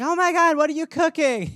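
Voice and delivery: high, loud eager pet; excited pet voice. Pretend. Pet begging owner at the stove, high excited voice. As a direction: squeal it like a begging cat. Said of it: "the pet's like".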